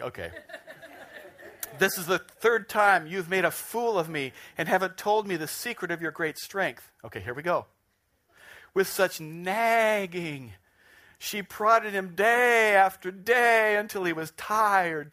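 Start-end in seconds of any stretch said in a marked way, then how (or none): 1.8-6.9 mock-aggrieved, raised and louder; hammed-up reproach, higher and forceful; complaining Delilah. Still reading Delilah's complaint aloud. 8.7-15.1 slow, drawn-out, high and loud reading; hammed-up, wearisome scripture reading; mock-dramatic nagging. Dramatized reading about Delilah's nagging.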